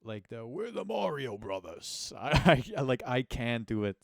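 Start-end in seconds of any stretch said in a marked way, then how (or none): 0.5-2.1 slow, lightly voiced Mario impression; a hint of old-school cartoon Mario; Super Mario voice. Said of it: "old school Super Mario show, Mario impression".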